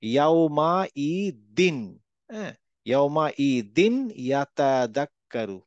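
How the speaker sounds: slow, drawn-out tajweed recitation; Quran recitation. Shown as a demonstration. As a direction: chant the verse slowly and carefully. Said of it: "এই যে এখানে". (ধীরে কুরআন তিলাওয়াত)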